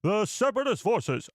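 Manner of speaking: high newsreel announcer; Clone Wars narrator. Acted. Streamer retrying the show's opening line, Clone Wars narrator impression. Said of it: "I'll do my impression".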